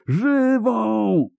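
drawn-out, very high howl; high, sustained wail of command; long howled shout. A narrator's reading. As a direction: howl it again, stretching the word out. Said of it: "zawył Riens".

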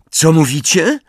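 high, projected demand to know; loud question. A narrator's reading. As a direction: call the question out into an echoing hall. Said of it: "zapytał tak głośno".

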